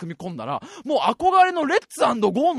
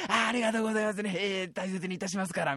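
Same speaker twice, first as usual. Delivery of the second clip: rakugo junk dealer; 5 dB quieter, faster than usual. Retold or imitated. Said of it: "落語で言うとこのね".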